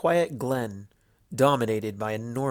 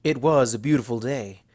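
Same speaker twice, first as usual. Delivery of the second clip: ordinary delivery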